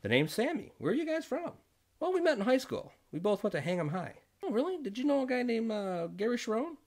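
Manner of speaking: high, bright newcomer's voice; Sammy voice. Retold or imitated. Newcomer Sammy asks the couple where they're from, voiced high. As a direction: pitch it up as the eager newcomer. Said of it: "The newcomer said".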